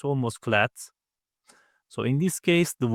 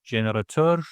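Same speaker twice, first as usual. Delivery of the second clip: ordinary talk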